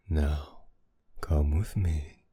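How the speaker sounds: usual speaking voice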